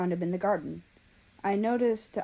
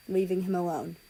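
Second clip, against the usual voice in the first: plain reading voice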